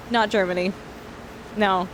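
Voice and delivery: usual speaking voice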